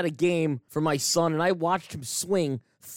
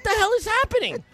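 much higher, incredulous; exasperated disbelief; 13 semitones higher than usual. Real, not acted. Host recounting the pizza mix-up, exasperated. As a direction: throw up your hands in voice.

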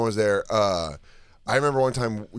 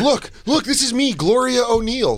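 much higher, loud mock psychic voice swooping up; fake psychic; 12 semitones higher, 3 dB louder than usual. Host mocks Miss Cleo dropping into her psychic voice. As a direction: ham up a fake psychic summoning spirits. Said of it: "She goes".

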